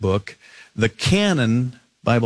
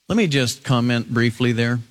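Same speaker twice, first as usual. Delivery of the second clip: usual speaking voice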